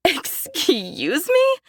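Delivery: very high, drawn-out disbelief; incredulous. Acted. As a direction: stretch it out in outrage. Daphne cutting mother off.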